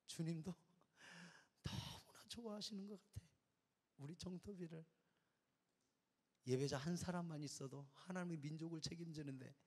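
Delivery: usual speaking voice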